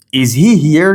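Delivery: "Is he here" lifted at the end; rising intonation. Shown as a demonstration. Teacher giving a yes-no question example, rising intonation. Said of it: "The intonation goes up, yes, no".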